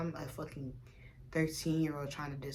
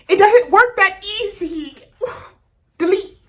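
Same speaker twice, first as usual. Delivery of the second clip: much louder and higher, fed up; exasperated outburst; 17 dB louder than usual. Real, not acted. Telling viewers research kept triggering her, exasperated and loud. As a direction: throw up your hands vocally.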